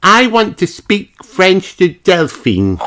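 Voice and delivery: put-on high, slow determined little girl; childlike high voice; Peppa voice. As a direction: say it with eager resolve.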